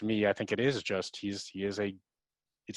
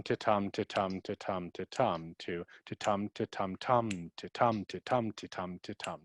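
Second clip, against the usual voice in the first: ta-tum chanted over and over, tum stressed, fading softer; steady iambic beat; 4 dB quieter than usual. Shown as a demonstration. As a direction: drum out the iamb like a metronome. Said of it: "a break from ta-tum".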